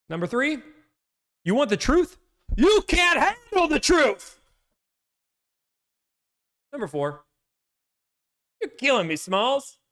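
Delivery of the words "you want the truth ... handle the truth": high, slow shouted outburst; courtroom outburst. Retold or imitated. Reading the list to chat, hammed-up shouted outburst. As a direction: yell it like a furious witness. Famous courtroom quote acted.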